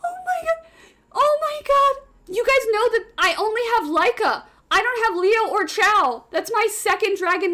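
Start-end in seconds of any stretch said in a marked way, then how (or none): 0.0-2.0 high-pitched stunned exclamation; astonished. Real, not acted. Streamer reacting to a lucky summon, astonished. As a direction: gasp it out high, in disbelief.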